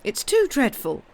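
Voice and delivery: high, loud distressed cry. Acted. Housekeeper protesting the cruel charge against the boy, crying out loud. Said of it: "cried Missus Groves".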